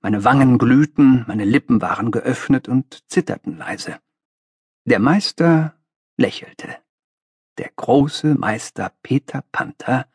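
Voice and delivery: ordinary delivery, nothing marked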